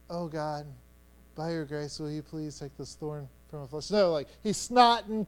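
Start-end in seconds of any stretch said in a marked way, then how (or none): 0.1-3.9 soft, low, polite little prayer; nice bedtime prayer. Acted. Man praying at night to God, nice and quiet. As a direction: murmur it mildly, as a tidy bedtime request. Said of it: "just this nice little prayer at night".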